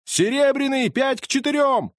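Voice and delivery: high, loud bookie call; crowd shout. Acted. Bookies shouting odds from the stands, high and loud. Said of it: "неслось с трибун".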